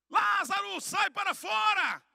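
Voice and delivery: high, loud cry with drawn-out final words; commanding shout. Retold or imitated. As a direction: call the dead man out at full voice. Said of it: "ele dá um grito".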